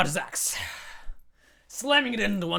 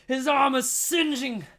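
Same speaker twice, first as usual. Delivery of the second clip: plain reading voice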